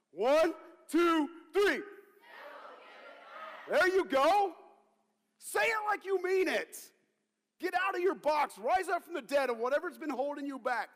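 loud, high full-force exhortation; rallying shout. Real, not acted. Preacher getting congregation to say it like they mean it, rallying shout. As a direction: whip the crowd up with a shouted call.

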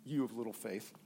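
low, soft, drowsy rebuke; sleepy mutter. Retold or imitated. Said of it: "he goes".

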